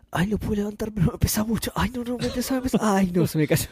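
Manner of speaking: straining under a heavy weight; groaning as the load slips; carrying heavy load. Retold or imitated. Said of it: "la garra fue todo el camino diciendo".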